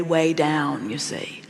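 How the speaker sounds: usual speaking voice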